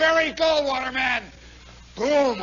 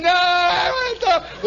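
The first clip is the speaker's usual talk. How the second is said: put-on slow, higher whining kid; stubborn kid; 9 semitones higher, 4 dB louder, slower than usual. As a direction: drag it out like a sulky child. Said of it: "kid refuses mother".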